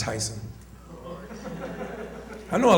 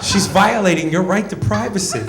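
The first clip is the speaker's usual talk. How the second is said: raised-pitch, indignant protest; mock outrage on a friend's behalf; offended friend; 4 semitones higher than usual. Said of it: "turn to me offended, like".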